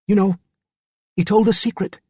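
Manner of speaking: put-on high boyish Cockney voice. A narrator's reading. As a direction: confide it as a young Cockney lad. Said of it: "it was a boy with a Cockney accent".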